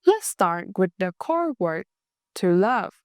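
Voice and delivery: ordinary delivery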